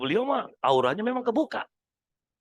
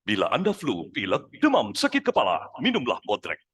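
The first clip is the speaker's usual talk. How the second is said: radio ad read; 8 semitones lower than usual. Pretend. Ex-hypnotist voices an old radio ad, lower, announcer style. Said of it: "Pernah dengar suara seperti ini?".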